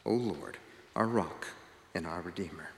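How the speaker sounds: soft, hushed closing prayer. Real, not acted. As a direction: pray softly, letting the phrases settle. Opening prayer before the sermon.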